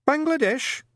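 a meek, polite recruit; timid young private. A narrator's reading.

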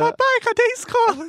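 put-on high whiny little kid; child voice. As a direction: ask like a lost little child. Voicing a child asking dad.

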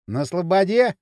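usual speaking voice

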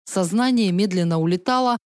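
usual speaking voice

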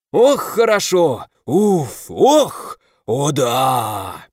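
высокие блаженные охи и ахи; постанывать от удовольствия в горячем пару; стоны наслаждения. A narrator's reading. Меч наслаждается жаром в бадье. (меч в бадье блаженно стонет)

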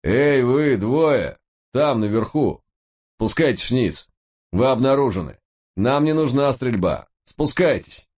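высокий медленный окрик в мегафон; объяви через мегафон; голос из громкоговорителя. A narrator's reading. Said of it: "голос из громкоговорителя". (объявление в громкоговоритель)

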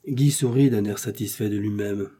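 ordinary delivery, nothing marked

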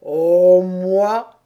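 loud, drawn-out turtle sigh of words; aveu de tortue. A narrator's reading. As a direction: confess it heavily. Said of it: "avoua tout à coup la tortue". (tortue qui avoue, voix appuyée)

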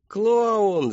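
high, loud, gleeful shout; stretched squealing answer; children yelling in chorus. A narrator's reading. Children shout their guess to the clown, yelling. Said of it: "заорали все хором".

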